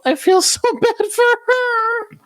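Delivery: very high, drawn-out whimper; mock-wailing sympathy. Reader feeling for the crying child, exaggerated sorrow. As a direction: overplay the pity in a high wail.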